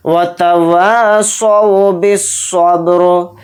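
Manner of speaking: slow, drawn-out tajweed chant; Quran recitation. Shown as a demonstration. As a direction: recite it slowly and melodically. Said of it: "रा को यहाँ पर साकिन बनाकर".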